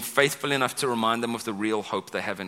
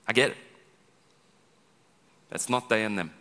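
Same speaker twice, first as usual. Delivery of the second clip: normal talking voice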